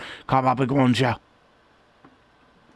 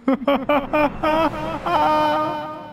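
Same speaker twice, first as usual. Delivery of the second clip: villain laugh; 7 semitones higher than usual. Acted. Character laughs in voice.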